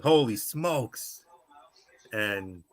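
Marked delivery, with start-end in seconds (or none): 0.0-1.2 slow, drawn-out, raised-pitch amazement; awed exclamation. Retold or imitated. Imagining his call of the high drop, awed exclamation. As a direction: stretch the words in mock awe. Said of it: "I would have been like".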